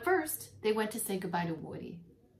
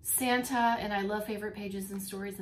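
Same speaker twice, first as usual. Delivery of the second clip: plain reading voice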